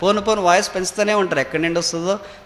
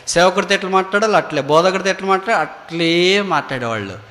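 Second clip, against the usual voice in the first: normal talking voice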